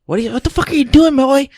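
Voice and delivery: high, loud mock yell; angry yell. Boom operator yelling at the mumbling actor, high and loud. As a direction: bark it like an annoyed boom operator.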